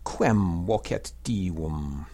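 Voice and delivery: slow, measured verse reading with drawn-out long syllables; Latin poem recitation. Shown as a demonstration. Teacher recites Horace's ode for learners, slow metrical Latin. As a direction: recite the ode steadily in metre, slowly.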